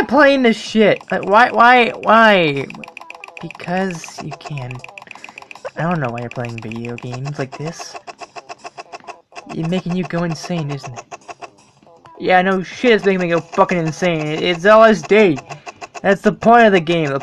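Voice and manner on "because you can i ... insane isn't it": soft inner voice. Acted. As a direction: murmur back as your inner voice. Inner voice answers again.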